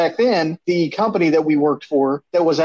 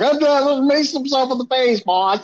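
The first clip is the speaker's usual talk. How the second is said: higher, mock panicked report to the boss; retold nervous phone call, voice pitched up; nervous kid reporting; 8 semitones higher than usual. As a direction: squeak it out like a scared twenty-two-year-old. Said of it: "I was like".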